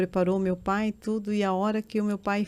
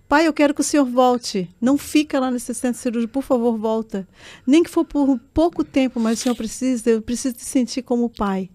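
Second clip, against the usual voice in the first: higher, louder, pleading through tears; desperate tearful plea; 4 semitones higher, 7 dB louder than usual. Retold or imitated. Daughter begging her father at the surgery door, loud tearful plea. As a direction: beg him to come back, voice near breaking. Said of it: "comecei a chorar".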